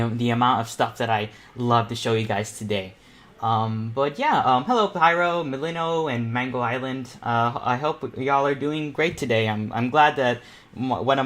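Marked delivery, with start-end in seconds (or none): none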